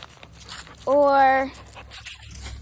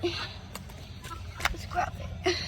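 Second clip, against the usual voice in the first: whisper; 12 dB quieter, much slower than usual. Real, not acted. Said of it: "whispered aside".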